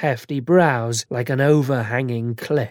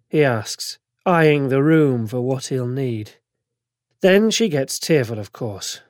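plain reading voice

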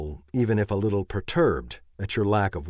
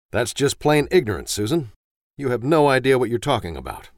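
defensive snap; 4 semitones higher, 5 dB louder than usual. Acted. Husband snapping back at wife.

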